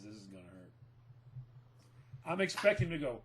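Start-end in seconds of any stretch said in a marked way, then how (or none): 0.0-0.7 low, much softer muttered warning; quiet aside. Retold or imitated. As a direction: murmur it under your breath to her. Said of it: "That's all I said was".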